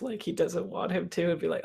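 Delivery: ordinary talk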